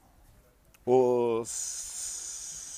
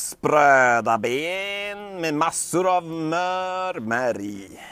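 high, slow, sweet-talking lure; luring villain voice; 5 semitones higher, 9 dB louder, much slower than usual. Acted. A villain tempting Fenris with bones, high and slow.

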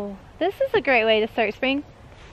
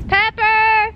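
loud, high call across the field; loud high-pitched call to a missing animal; 10 semitones higher, 4 dB louder than usual. Real, not acted. Calling animals across the farm.

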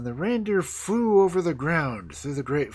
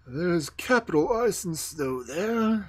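slow, higher put-on animal voice; talking reindeer; 7 semitones higher, 3 dB louder, slower than usual. A narrator's reading. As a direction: savour it slowly as the reindeer. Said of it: "said the reindeer".

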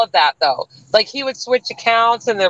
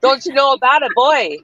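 higher, lightly hammed-up Canadian accent; mock Canadian; 5 semitones higher than usual. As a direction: play along with a folksy Canadian lilt. Joins in mock Canadian banter.

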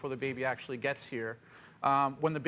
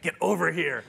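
firm, louder bark of a command; frustrated owner calling the dog; harsh command; 5 dB louder than usual. Shown as a demonstration. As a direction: snap it at the dog. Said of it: "you don't wanna use that".

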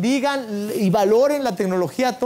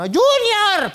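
high-pitched mock yell for a child; mother calling out; 12 semitones higher, 4 dB louder than usual. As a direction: holler him home like a suffering mum. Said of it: "mi mamá, cómo sufría para que yo me metiera".

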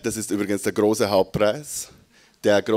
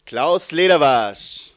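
slow, louder, drawn-out announcement of the name; 4 semitones higher, 5 dB louder, slower than usual. Real, not acted. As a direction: announce the winner's name to the hall. Moderator announces drawn poet's name.

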